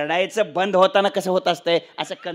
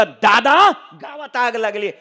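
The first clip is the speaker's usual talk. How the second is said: raised, mock-distressed cry to father; tearful son; 3 semitones higher, 7 dB louder than usual. Eldest son tells father of the fire, theatrical cry. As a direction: call out to dad as a distressed son. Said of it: "थोरलं पोरग रडतच आलं".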